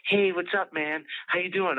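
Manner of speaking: high, chummy fake-nice hello; mock friendly greeting. Mocking the internet tough guy greeting him in person. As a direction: play the two-faced buddy saying hi.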